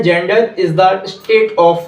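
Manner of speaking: very slow, every English word spaced out; slow, clear dictation for students to copy. Shown as a demonstration. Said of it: "gender की definition है".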